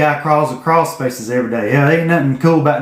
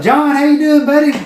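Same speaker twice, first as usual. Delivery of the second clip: put-on higher, upbeat hello to an old buddy; mock cheery greeting; 11 semitones higher, 3 dB louder than usual. Answering the phone to John, cheery and high. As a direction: pitch it up like greeting a pal.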